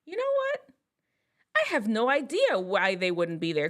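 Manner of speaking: ordinary talk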